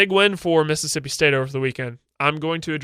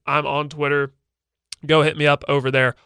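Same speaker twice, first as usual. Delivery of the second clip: usual speaking voice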